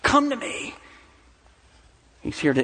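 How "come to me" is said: high, sharp barked command; a demanding, high-pitched call; mock harsh shout. Shown as a demonstration. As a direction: snap it out like an impatient parent. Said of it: "He's not here to shout at us".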